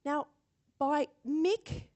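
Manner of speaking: ordinary talk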